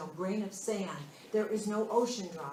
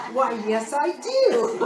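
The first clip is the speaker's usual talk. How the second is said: loud, high, through real laughter; laughing reply; 5 semitones higher, 11 dB louder, slower than usual. Real, not acted. Co-host answers "don't you?", laughing. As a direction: answer while cracking up. Amused answer to co-host's question.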